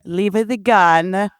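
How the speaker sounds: put-on slow, heavy Italian accent; Italian grandma voice. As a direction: play an old Italian nonna. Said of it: "That's a character, too".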